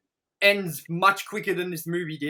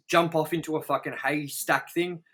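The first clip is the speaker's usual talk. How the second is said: usual speaking voice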